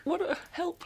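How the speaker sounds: high squeaky cartoon exclamation; relieved inner voice. Her brain reacting to a funny song, squeaky and high. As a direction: pop it up high like a relieved little voice in her head. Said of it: "my brain was like".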